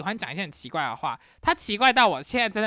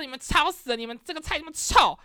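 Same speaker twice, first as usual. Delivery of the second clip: higher, heated shouting; mock furious complaint; retelling her shouting about the noise and smelly food; retold roar; 8 semitones higher than usual. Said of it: "然后就开始大吼".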